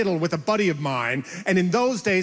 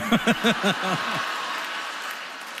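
laugh. Real, not acted. Laughs mid story.

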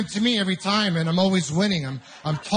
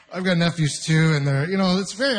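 usual speaking voice

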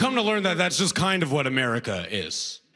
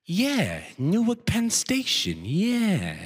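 put-on higher, slow, clueless old sidekick; senile older guy; 5 semitones higher, much slower than usual. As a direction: draw it out like a senile echo. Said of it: "the second older guy goes".

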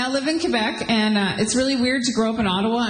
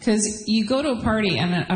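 ordinary delivery

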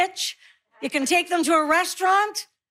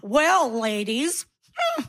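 slow, drawn-out flirty come-on; mock flirting; much slower than usual. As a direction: imitate a patronizing flirt, stretching the words. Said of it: "I'm gonna flirt with you".

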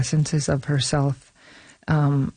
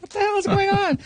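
high-pitched, comic mock disbelief; exasperated disbelief; faster than usual. Director recalling her own thought while playing the mom, mock disbelief. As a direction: blurt it out much higher, playing up the disbelief.

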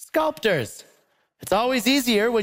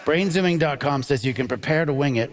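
mocking reread; 8 semitones lower than usual. Shown as a demonstration. Host rereading a silly question to the crowd, mockingly and lower. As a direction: reread Brainzooming dot com in a lower, scornful voice. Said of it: "which is already stupid".

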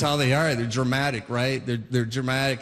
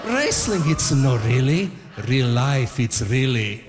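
heavy Italian dad; 7 semitones lower, 3 dB louder, slower than usual. Retold or imitated. Dad's verdict on wrestling.

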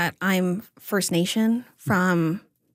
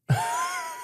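high giggle; 5 dB quieter than usual. Real, not acted. Laughing about House Hippo.

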